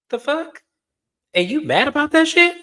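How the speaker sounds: can't believe what she's hearing; outraged disbelief. Real, not acted. Host reacting to Georgia blaming Charlie, incredulous outburst.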